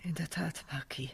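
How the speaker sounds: tonlos geflüstert, sehr leise; whisper. Acted. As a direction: murmur it under your breath. Intimes Gespräch, geflüstert. (leise geflüsterte Bestätigung)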